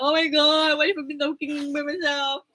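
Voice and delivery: playful worried inner voice; half-joking alarm at a silent chat; mock panic. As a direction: act out the fear of talking alone.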